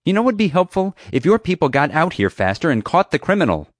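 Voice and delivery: high, loud, indignant rant; heated, high-pitched outburst; angry. A narrator's reading. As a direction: tell off an unhelpful dispatcher. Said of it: "Summer said angrily".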